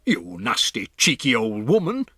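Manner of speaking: a pompous man scolding; mildly voiced angry shout. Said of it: "shouted Mister Jenkins".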